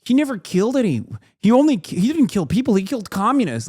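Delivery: high-pitched parody of a debater; mocking imitation. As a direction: parrot his indignant denial up high. Mockingly parrots the debater's defence.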